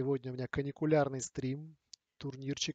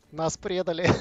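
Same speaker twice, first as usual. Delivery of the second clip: normal talking voice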